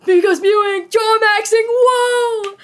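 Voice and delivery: high, loud, excited exclamation. Real, not acted. Reads chat excitedly.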